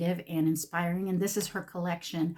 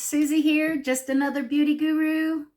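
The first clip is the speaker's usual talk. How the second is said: beauty vlogger voice; 8 semitones higher, 7 dB louder than usual. Pretend. Bubbly beauty vlogger intro.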